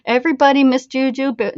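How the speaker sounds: ordinary delivery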